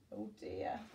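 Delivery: breathy whispered dismay; hushed oh dear to the child. A narrator's reading. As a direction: whisper it like a secret sigh. Dismay whispered after mishap.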